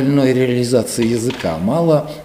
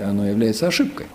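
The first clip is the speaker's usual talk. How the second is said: ordinary talk, nothing marked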